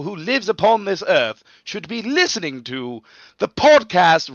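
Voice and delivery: loud, high, slow royal proclamation; roaring tyrant king decree; King of England voice. Acted. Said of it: "in your King of England voice".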